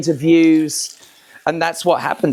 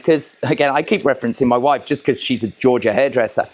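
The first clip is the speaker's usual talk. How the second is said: ordinary talk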